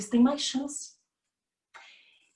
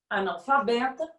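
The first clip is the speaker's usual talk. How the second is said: ordinary talk, nothing marked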